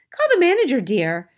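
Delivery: high, loud order, dropping on the last word; indignant wife. A narrator's reading. Missus Jenkins orders her husband, high and indignant. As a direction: call out shrilly, then settle.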